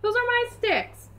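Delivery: high mock-indignant dog voice; voicing the dog. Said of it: "Lulu would be like".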